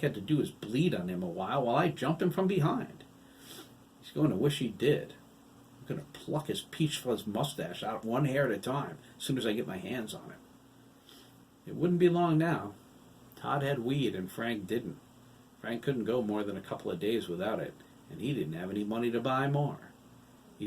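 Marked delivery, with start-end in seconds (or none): none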